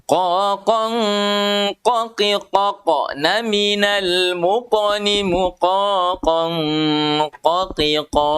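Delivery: "qa", "qi", "qan" read out as practice syllables; teacher reads the qaf exercise aloud; qaf drill. Shown as a demonstration. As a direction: drill the practice line slowly. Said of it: "contoh seperti ini".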